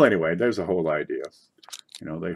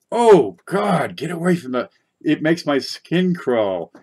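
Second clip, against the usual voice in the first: disgust; 7 semitones higher, 8 dB louder, slower than usual. Real, not acted. Disgust at staple scratches.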